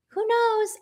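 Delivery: a high, teasing "Who knows?"; sing-song shrug. Real, not acted.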